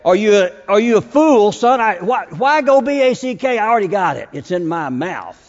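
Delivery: high, loud indignant dog talking back; the dog scolding its master, high and loud; dog's voice. Pretend. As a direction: scoff like a dog who already has the bumper. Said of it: "he's gonna say".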